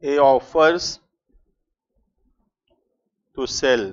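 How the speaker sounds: slow, word by word, leaving pauses to write; slow dictation. Acted. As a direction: dictate it so the class can copy. Teacher dictating example.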